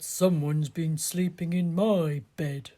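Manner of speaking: a bear dad grumbling about his bed; Daddy Bear. Acted. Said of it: "Daddy Bear said".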